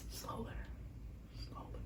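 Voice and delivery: barely audible self-reminder; whispered to self. Real, not acted. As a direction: whisper it under your breath, word by word. Reminding herself to slow down.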